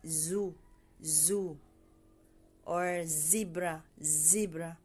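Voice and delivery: "zoo" and "zebra" with a low, lengthened Z; buzzed Z. Shown as a demonstration. Teacher gives example words for the letter, buzzed Z. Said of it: "Ang Z sound like".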